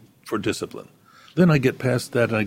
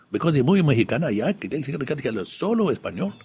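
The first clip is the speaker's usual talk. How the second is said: higher, Spanish-flavoured San Antonio Texas accent; 5 semitones higher than usual. Shown as a demonstration. Guest showing the host how San Antonio sounds, in a San Antonio Texas accent. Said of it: "a Texas accent is kinda like this".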